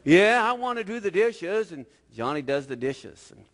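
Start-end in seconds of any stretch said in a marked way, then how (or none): 0.1-1.7 high, mock-willing little boy; blackmailed kid playing along; grudging compliance. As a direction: give in with forced eagerness. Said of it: "Johnny goes".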